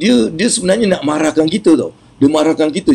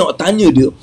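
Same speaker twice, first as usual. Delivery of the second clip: ordinary delivery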